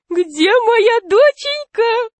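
slow, squeaky-high cooing fat man; thin high voice. A narrator's reading. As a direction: pipe it out in falsetto, drawn out. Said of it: "сказал толстяк тонким голосом".

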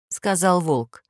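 plain reading voice

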